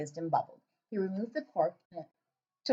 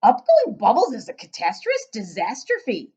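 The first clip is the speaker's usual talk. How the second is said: booming, slow giant with odd grammar; loud giant voice; 10 dB louder than usual. Acted. Giant questioning the girl, booming and puzzled.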